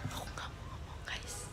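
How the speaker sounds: very soft, voiceless whisper; hushed confiding whisper to the viewers. Shown as a demonstration. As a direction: whisper it to hide the troublesome voice. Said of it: "kalau bisik-bisik suara aku normal".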